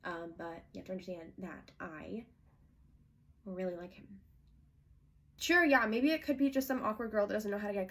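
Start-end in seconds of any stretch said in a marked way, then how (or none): 0.0-4.1 much softer, hesitant plea; soft pleading. Acted. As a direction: plead quietly and carefully.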